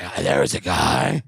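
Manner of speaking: imitating a dramatic storyteller; hammed-up eerie whisper-like telling; hushed creepy mock narration; mock spooky voice. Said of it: "Why are you talking like that?".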